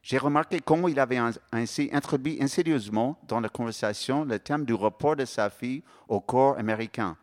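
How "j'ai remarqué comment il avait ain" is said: ordinary delivery, nothing marked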